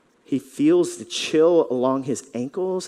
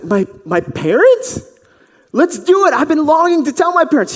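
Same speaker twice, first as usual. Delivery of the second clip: high, loud, eager; delighted; 12 semitones higher, 8 dB louder than usual. Acted. Healed man hearing his parents will be asked, delighted. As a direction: jump at the idea. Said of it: "this man's like".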